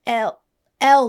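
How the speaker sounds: pointedly punched letters; exasperated spelling. Retold or imitated. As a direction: spell it out hard for a confused clerk. Spelling name for confused clerk.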